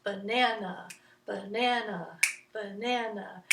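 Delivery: rhythmic 'banana', second syllable stressed; ba-NA-na, evenly repeated; middle-syllable emphasis. Shown as a demonstration. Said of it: "where you would put the emphasis".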